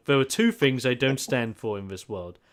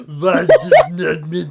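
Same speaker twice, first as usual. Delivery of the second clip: laughing mock outrage; 4 semitones higher, 10 dB louder, slower than usual. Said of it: "flame me".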